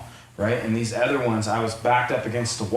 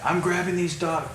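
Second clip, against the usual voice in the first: pitched-up mock of a mouthy kid; a lightly hammed-up young hothead; mocking the angry kid; 7 semitones higher than usual. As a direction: imitate the kid's bratty rant. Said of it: "starts mouthing off".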